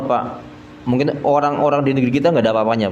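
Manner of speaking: ordinary talk, nothing marked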